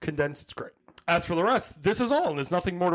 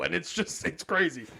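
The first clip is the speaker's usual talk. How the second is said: ordinary talk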